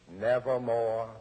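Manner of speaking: low, deep answer from the bird; raven's reply. A narrator's reading. Raven answers the grieving narrator, low and ominous. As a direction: intone it like a dark raven.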